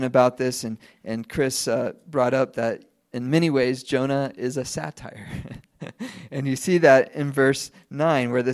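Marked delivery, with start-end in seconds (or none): none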